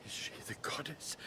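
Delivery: fast, breathy whisper; awed whisper. Acted. The king asking his son about the maid, hushed whisper. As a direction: whisper the question in disbelief.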